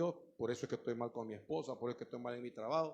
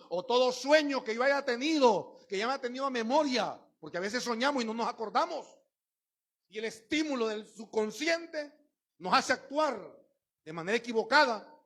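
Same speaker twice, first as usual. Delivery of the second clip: louder, higher preaching push; fiery preaching; 9 semitones higher, 8 dB louder than usual. Acted. Pastor exhorting the congregation, fiery. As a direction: drive it home to the church.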